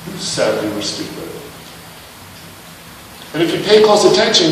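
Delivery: ordinary talk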